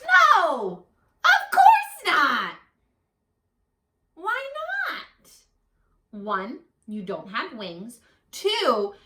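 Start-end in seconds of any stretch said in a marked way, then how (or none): 0.0-2.5 loud, high, slowing "of course not"; mock-stern parent voice; firm refusal. Parent firmly refuses frog. 4.1-6.2 very high, small protesting voice; a disappointed child asking why; high squeaky frog; whiny little frog. Acted.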